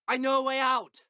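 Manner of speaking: very high, excited call without extra volume; urgent exclamation. Acted. Trapped adventurer announcing an escape route, urgent exclamation.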